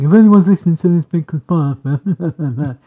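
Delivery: slow put-on announcer voice; mock announcer. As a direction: draw it out like a jokey show intro. Mock announcement, then laughs.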